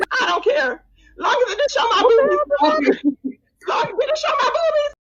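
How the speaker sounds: very high chipmunk voice, shrieking with laughter; squeaky sped-up clip voice. Pretend. Host replays her old voice-changer bit, squeaky and shrieking. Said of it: "go down memory lane with Sir King".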